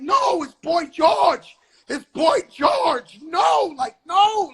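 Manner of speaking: high, slow, loud pleading shout; panicked shout. Retold or imitated. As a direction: yell it out to stop your friend. Friends pulling him back, panicked.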